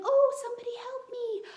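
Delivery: high, loud wail for help; mock helpless cry. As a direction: ham up a panicky damsel calling for help. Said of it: "instead of saying".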